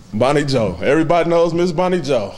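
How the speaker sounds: usual speaking voice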